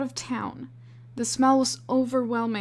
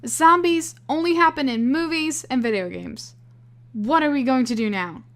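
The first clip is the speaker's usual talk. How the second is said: panicked; 4 semitones higher, 6 dB louder than usual. Acted. Panicked disbelief.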